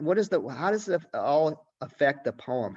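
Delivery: normal talking voice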